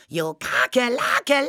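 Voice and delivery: high-pitched creature call, piling up syllables; insistent spook cry. A narrator's reading. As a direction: shriek it right into the sleeper's ear. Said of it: "jokakelte noch einmal noch lauter". (hoher, aufdringlicher Spukschrei)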